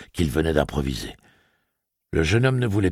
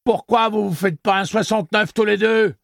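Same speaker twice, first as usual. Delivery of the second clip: high, loud jeering bawl; bawling taunt; 5 dB louder than usual. Acted. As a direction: bawl the obscene taunt while struggling. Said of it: "braillait la créature".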